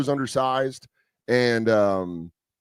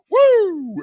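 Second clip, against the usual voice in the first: high, loud whoop of joy; joyful whoop; 6 dB louder than usual. Retold or imitated. Comic retelling a free oil change, a loud high whoop. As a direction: cheer like you just won. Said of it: "And I was like".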